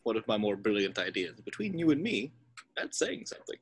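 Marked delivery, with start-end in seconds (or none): 1.4-3.6 confiding aside. Acted.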